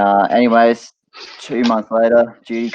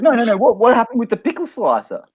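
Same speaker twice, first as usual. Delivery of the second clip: high wife voice; 14 semitones higher than usual. Acted. Shocked wife in the joke demanding answers, high falsetto. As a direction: push the question up into a squeaky voice. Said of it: "And she goes".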